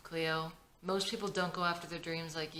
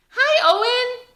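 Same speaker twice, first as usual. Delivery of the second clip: put-on kids chorus; 15 dB louder, slower than usual. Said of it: "kids greet Owen together".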